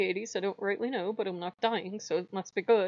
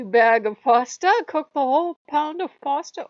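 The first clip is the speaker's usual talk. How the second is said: mock; 6 semitones higher, 8 dB louder than usual. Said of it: "Well, whatever you say".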